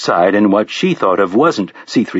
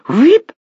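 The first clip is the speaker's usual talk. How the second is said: high, louder electronic wheep; robotic whistle; 4 dB louder than usual. A narrator's reading. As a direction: whistle like a relay clicking. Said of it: "a little whistling noise".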